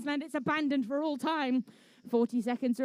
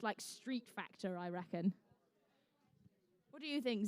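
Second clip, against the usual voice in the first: normal talking voice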